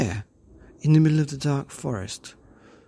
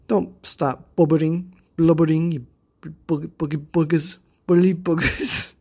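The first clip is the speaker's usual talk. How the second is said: lightly raised teasing scold; mocking Pippi; 4 semitones higher, 6 dB louder than usual. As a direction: laugh at the crybabies. Pippi mocks crying bullies.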